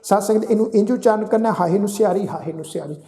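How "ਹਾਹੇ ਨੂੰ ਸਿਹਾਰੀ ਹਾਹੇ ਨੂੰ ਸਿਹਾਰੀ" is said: ordinary delivery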